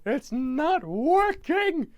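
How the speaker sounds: very high, loud, slow yell; mock frustration. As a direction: wail it in comic despair. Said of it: "And he goes".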